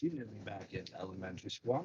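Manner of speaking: muffled, as if mic is covered. Real, not acted. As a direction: talk with a hand over the mic. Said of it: "your audio's a little muffled".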